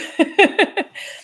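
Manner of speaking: a high, breathy burst of real laughter; real amused laugh. Real, not acted. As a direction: laugh out loud, delighted. Laughing at viewer comment.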